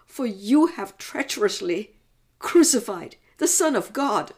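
high, loud, heartbroken outcry; anguished, protesting mother. Acted. Mother asks God how his promise can be fulfilled, anguished outcry. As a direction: cry out to God in bewildered protest.

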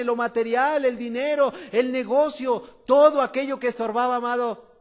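loud, driving preaching; fervent preacher on a roll; full-force preaching. Acted. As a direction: hammer each point home.

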